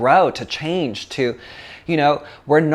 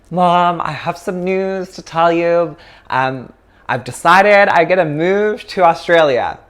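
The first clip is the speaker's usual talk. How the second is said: higher-pitched retold announcement; announcing news; 6 semitones higher, 5 dB louder than usual. Daughter tells mom she is moving away, announcing news. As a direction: break big news to a parent, pitched up. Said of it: "and I'm like".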